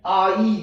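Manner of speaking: आई said as a clear 'aa-ee' glide; sound demo. Shown as a demonstration. Teacher showing children the आई sound, clearly drawn out. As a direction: blend आ into ई in one long sound. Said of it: "next आई से बनने वाली sound है".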